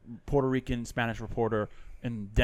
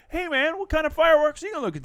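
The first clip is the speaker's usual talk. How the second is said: much higher, quick, eager reporter voice; nerdy reporter; 4 dB louder than usual. Retold or imitated. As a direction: mimic a dorky TV reporter chirping at a kid. Nerdy reporter asking a kid.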